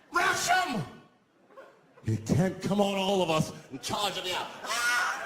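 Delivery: rally the passengers to attack; rallying yell. Retold or imitated. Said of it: "He screamed out".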